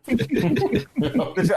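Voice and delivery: high giggling laugh; laughing hard. Real, not acted. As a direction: breaks into laughter. Laughing fit.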